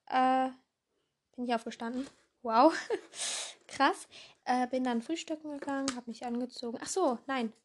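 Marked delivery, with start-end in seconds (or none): none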